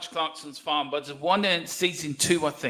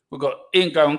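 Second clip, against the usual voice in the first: usual speaking voice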